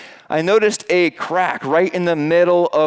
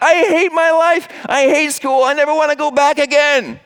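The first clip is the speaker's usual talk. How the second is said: put-on much higher, louder childish wail; whining kid; 10 semitones higher, 4 dB louder than usual. Kid whining after school.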